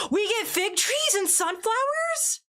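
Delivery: gasp, then high, slow, amazed question; delighted gasp. Real, not acted. Streamer finding new pack items, gasping in high delight.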